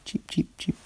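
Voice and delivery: whispered chick peeps; chick cheeping. Shown as a demonstration. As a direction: softly imitate baby chicks. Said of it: "Did you hear the cheeping?".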